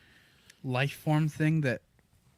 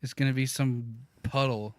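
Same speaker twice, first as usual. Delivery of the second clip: usual speaking voice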